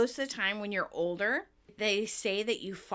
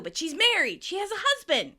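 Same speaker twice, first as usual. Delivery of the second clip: raised pitch, louder, insistent; exasperated protest; 9 semitones higher, 5 dB louder than usual. Retold or imitated. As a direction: protest it as the torn lover. Retold protest of torn Chandler.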